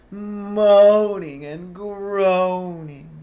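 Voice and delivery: high, loud, slow drawn-out moan. Shown as a demonstration. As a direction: moan it out slowly and loudly.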